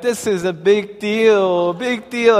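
high, drawn-out mock whine; slow, high wail imitating an upset son; lightly acted tearful complaint; imitated crying child. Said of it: "He was, like, crying".

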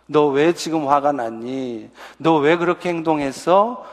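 calm, gentle, slow questioning; low, soft, unhurried parent voice. Shown as a demonstration. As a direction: ask softly and slowly like a patient parent. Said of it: "하고 차분하게".

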